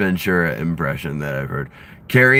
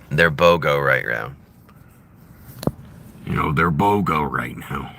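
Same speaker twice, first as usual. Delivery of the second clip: a hint of a gruff wrestler-governor; Jesse Ventura impression; 7 semitones lower than usual. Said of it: "as Jesse Ventura would say".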